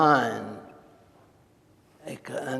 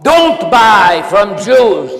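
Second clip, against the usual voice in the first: loud, slow chanted command; shouted slogan; 5 semitones higher, 13 dB louder, slower than usual. Acted. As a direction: bark it at the shop door. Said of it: "shouting slogans and holding signs".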